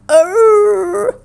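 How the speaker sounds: high groaning arr; a shuddering moan from hiding; groan from the bush. A narrator's reading. Ava groans from under the bush with toothache. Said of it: "shuddered the bush".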